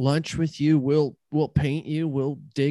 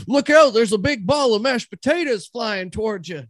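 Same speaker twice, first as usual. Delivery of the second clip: alarmed shout; 8 semitones higher, 4 dB louder than usual. Acted. Warning of the flying potatoes.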